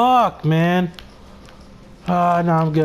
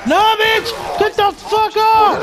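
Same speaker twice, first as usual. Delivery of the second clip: much higher, frantic yelling at a zombie; real panicked yell; 12 semitones higher than usual. Real, not acted. Gamer yelling at the zombie to let go. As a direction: shriek it off as the zombie grabs. Zombie grabs him in game.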